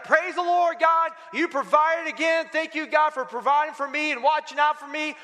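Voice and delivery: high-pitched, thankful mock cry; imitated jubilant praise. Lady thanks God for the groceries, imitated jubilant praise. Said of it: "And she's like".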